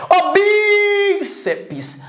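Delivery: high, slow call with a held vowel; long drawn-out call. Shown as a demonstration. Teacher hollering the example bíceps, stretched call.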